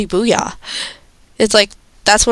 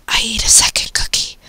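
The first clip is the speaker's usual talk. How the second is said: whispered quote. Retold or imitated. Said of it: "it's like".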